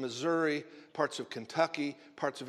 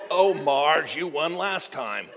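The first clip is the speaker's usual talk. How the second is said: gravelly smoker's voice, louder; gravelly old woman voice; 7 dB louder, slower than usual. Retold or imitated. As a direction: croak it like an old bingo lady. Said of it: "her voice was kinda like that".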